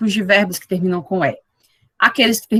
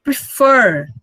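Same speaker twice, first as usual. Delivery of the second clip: Prefer with the second syllable long and strong; "Prefer" with a louder, falling final syllable; stress on second syllable; 3 semitones higher, 5 dB louder than usual. Shown as a demonstration. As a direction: lean hard on the end of "Prefer". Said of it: "Aí eu tenho que pronunciar".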